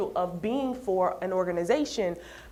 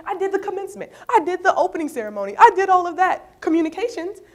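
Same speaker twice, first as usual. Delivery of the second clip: retold indignant rant, higher and louder, dwelling on each claim; frustrated rant; 5 semitones higher, 5 dB louder than usual. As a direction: let the friend's frustration spill out as you list everything he did. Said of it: "He was like".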